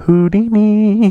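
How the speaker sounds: slow, drawn-out grand announcement; dramatic reveal. Acted. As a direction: unveil the name like a showman. Grand reveal of bike name.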